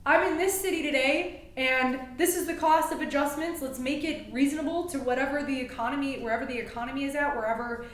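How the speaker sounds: loud, high, pumped-up call to a crowd; brash road-trip hype; hyped announcement. Retold or imitated. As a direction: shout it like you just rolled into town. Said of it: "be like".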